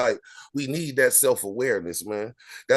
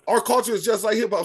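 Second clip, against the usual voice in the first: raised, higher voice to cut in; cutting in over the interruption, voice raised high; talking over; 7 semitones higher than usual. Real, not acted. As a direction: push the line over the other speaker, pitched up. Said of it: "Sorry, I'm gonna cut you off".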